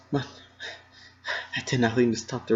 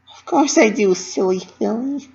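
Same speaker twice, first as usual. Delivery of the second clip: high, bright, fond reassurance; Pinkie reassuring; 10 semitones higher, 3 dB louder than usual. A narrator's reading. Pinkie forgiving Dash, bright and fond. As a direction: soothe her like a loving friend.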